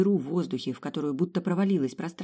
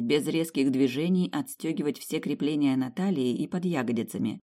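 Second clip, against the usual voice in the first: plain reading voice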